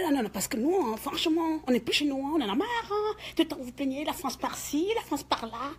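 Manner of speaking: a hint of a mocking complainer; mocking the neighbour. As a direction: mimic her whiny grumbling about France. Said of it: "elle dit".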